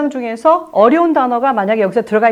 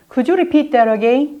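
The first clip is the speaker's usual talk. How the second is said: slow formal request, rising at the end; much slower than usual. Shown as a demonstration. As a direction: ask formally, stretching each word. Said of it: "조금 더 격식 있게 한다면".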